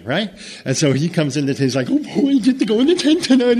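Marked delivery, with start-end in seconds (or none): 1.9-3.6 high, eager puppy voice, a lot louder; much higher and louder happy dog; excited dog voice. Acted. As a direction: play the thrilled dog squealing with joy.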